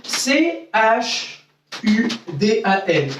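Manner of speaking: letters C H U D A N spelled out slowly one by one; slow spelling. Shown as a demonstration. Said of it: "Ça s'écrit". (épeler C H U D A N lentement)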